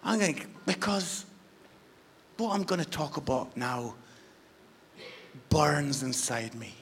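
low whispered build-up; quiet, burning whisper; hushed intensity. Real, not acted. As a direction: hold it back, barely voiced. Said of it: "I'm gonna get a bit passionate now".

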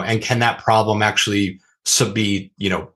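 ordinary talk

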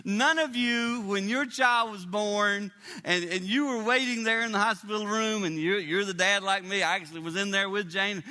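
ordinary talk, nothing marked

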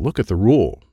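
plain reading voice

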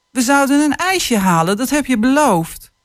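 hint of a child whining; child's protest. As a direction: plead like a disappointed five-year-old. [name] reminds father of promise.

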